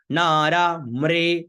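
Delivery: नारा मृ slow, मृ said "mre"; "re" pronunciation. Shown as a demonstration. Teacher showing मृ as "mre", slow and sustained. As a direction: draw out नारा मृ with "re", not "ri". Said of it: "रे का उच्चारण करना है".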